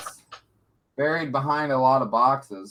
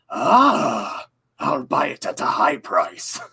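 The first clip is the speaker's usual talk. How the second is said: merchant impression; much slower than usual. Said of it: "'cause he goes".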